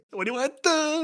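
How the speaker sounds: high hammed-up come-on voice; quoting the line. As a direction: squeal the cheesy pickup line. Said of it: "my favorite".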